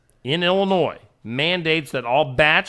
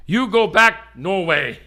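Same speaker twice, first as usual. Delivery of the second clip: mock border official; 6 semitones higher, 5 dB louder, slower than usual. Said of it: "denied entry and sent".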